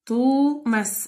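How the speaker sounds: "توماس" slowly, both vowels lengthened; teacher sounding out "توماس" slowly for its long vowels; drawn-out vowels. Shown as a demonstration. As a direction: stretch the "و" and the "ا" in "توماس". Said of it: "فيها مد بالواو ومد بالألف".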